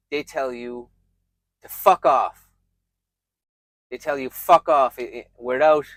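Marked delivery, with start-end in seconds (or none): 1.6-2.5 loud, blunt dismissal; punched, falling brush-off; retold rude rebuff. Quoted rude reply to a compliment. 4.3-5.0 loud, blunt dismissal; punched, falling brush-off; retold rude rebuff. Quoted rude reply to a compliment.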